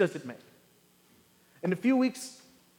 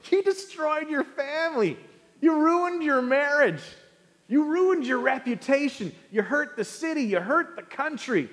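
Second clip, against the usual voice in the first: higher, indignant accusing; mock outrage; 6 semitones higher than usual. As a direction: rail at the politician on TV. Viewer scolding politician.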